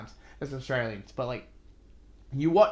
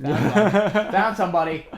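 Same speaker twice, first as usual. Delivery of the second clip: laughing out a mock discovery, louder; a louder quote breaking into a laugh; laughing mock find; 6 semitones higher, 11 dB louder than usual. To a co-host, laughing as she mocks finding talent.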